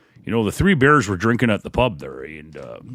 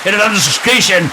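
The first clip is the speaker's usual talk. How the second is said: announcer; 8 semitones higher than usual. Acted. Announcer opening of show.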